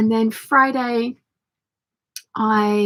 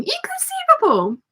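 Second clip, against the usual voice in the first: high shrieking exclamation; Vizzini impression. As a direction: squeal it like Vizzini. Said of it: "Vicini keeps saying".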